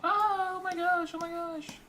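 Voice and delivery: very high falsetto, drawn out; a frightened bystander shrieking; shrill comic panic as the fight breaks out; panicked squeal. Acted.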